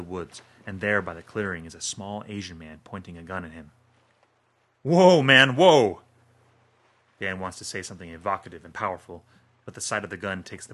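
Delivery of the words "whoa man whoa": high, loud frightened cry; startled fear. Acted. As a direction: jolt back in panic at the gun. Dan sees gun pointed at him.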